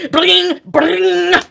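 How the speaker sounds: loud, drawn-out ringing noise; imitated phone ringing. Woken by a phone blowing up, imitated ringing. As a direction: trill it like a phone going off.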